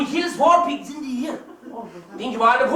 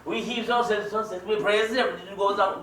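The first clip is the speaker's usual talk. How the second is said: usual speaking voice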